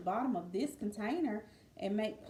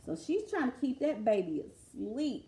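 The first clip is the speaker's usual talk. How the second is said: ordinary delivery, nothing marked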